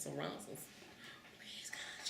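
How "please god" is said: whispered desperate plea; whispered prayer. Retold or imitated. Cousin praying in the back seat, whispered. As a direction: breathe a frightened prayer. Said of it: "she's praying".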